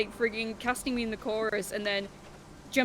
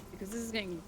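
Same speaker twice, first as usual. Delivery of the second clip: normal talking voice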